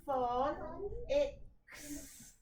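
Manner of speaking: phon, ix, then blended up into phonics; slow syllable blending; split into syllables. Shown as a demonstration. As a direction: sound it out in chunks then blend. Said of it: "I've split".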